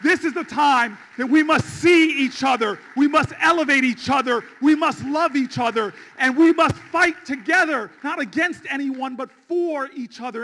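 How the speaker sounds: fiery campaign close; full rally preaching. Acted. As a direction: rouse the crowd, hammer each line. Rallying crowd finale.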